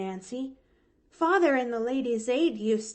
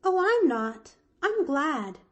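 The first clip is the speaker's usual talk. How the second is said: put-on high, louder cheerful child; glad little girl; 9 semitones higher, 6 dB louder than usual. A narrator's reading. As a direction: contradict happily as a child. Pollyanna cheerfully contradicts Nancy.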